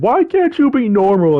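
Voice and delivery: high-pitched mock yell of an exasperated mom. Retelling a meme of a mom and her kid, mock yelling. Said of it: "that mom yelling at the kid".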